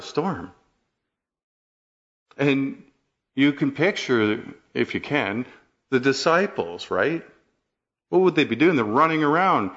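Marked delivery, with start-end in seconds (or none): none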